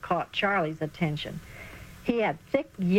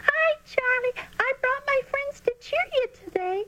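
put-on a girl cheering for Charlie from the stands, much higher and squeaky. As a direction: pipe it up high and squeaky like a young girl. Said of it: "a squeaky voice called out".

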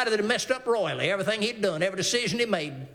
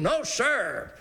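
ordinary talk

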